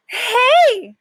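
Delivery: very high, loud yell; startled shout. Acted. The dog yelps as the new dog enters his cage, high and loud. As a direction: blurt it out without thinking. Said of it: "I say automatically".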